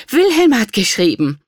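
high, loud exclamation; excited mother's cry; delighted. A narrator's reading. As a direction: burst out with the happy news. Mother gets son's letter.

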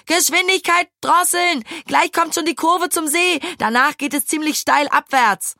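high, urgent cry easing slightly towards the end; panicked warning. A narrator's reading. Maja warns of the steep curve, panicked cry. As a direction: warn in panic of danger ahead. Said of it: "schrie Maja plötzlich panisch".

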